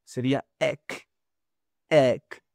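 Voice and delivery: "ek" with the tongue back; open e demo. Shown as a demonstration. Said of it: "la lengua hacia atrás". ("ek" con la lengua hacia atrás)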